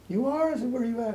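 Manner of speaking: high, eager mother's voice; imitated excited mother. Mother asks how close son is, imitated excitement.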